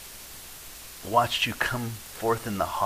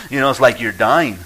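mock anguish; 9 dB louder than usual. Mock horror at being asked.

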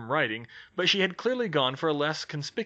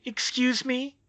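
higher, offended Excuse me; indignant; 11 semitones higher, slower than usual. Acted. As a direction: bristle at the accusation.

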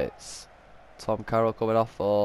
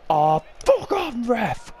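furious at ref; 12 semitones higher, slower than usual. Real, not acted. Gamer yelling at the ref over a bad call, furious shout. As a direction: tell the ref where to go, full rage.

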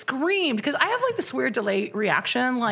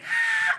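much higher, sudden scream of fright "Ah!"; horror movie scream. Retold or imitated. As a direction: shriek like someone in a horror film. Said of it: "and I screamed".

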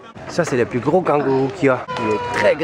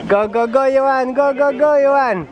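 cheering on; 7 semitones higher than usual. Real, not acted. Cheering son on a slide.

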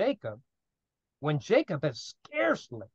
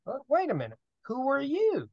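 bewildered question; 8 semitones higher, 3 dB quieter than usual. Retold or imitated. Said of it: "So he's saying".

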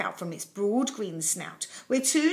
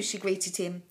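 plain reading voice

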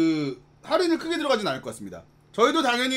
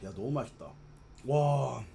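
chewing while talking; eating fried rice between words; mouth full; 10 semitones lower, 10 dB quieter, slower than usual. Real, not acted. Seen 1: Face clearly seen, mouth working on food while scooping rice.

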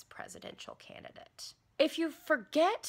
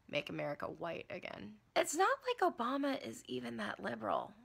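usual speaking voice

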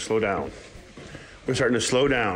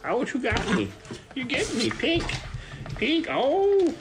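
much higher playful yelps; squeaky ouch; slower than usual. Real, not acted. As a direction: squeal at the nipping pup.

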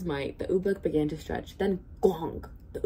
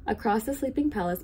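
plain reading voice

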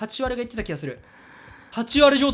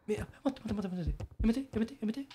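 much softer, frightened pleading; hushed real fear; scared whisper-quiet; 2 semitones lower, 10 dB quieter, faster than usual. Real, not acted. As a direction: keep it down, terrified. Hiding from monster.

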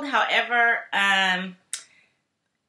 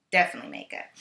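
Definitely makeup dropped much lower; low repeat; 10 semitones lower, much faster than usual. Shown as a demonstration.

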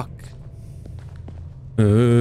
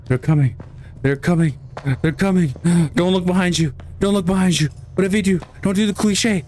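rising panic; 8 semitones higher, faster than usual. Real, not acted. Urging himself while chased.

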